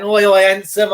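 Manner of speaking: cheeky friendly call-out. Retold or imitated. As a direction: hail a friend from the top of the stairs. Calling out to a friend.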